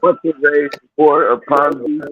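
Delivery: high-pitched hammed-up medieval challenge; mock old English challenge. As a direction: challenge them like a pretend knight. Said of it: "Thou, thou".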